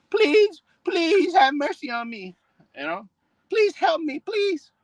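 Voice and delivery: high, falsetto mock pleading; mock begging woman. Preacher mocking women begging men for help, high falsetto plea. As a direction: whine it out like a desperate woman begging for mercy. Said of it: "now they gonna come begging your ass for some help".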